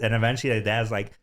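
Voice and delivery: usual speaking voice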